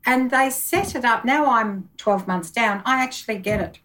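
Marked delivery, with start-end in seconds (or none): none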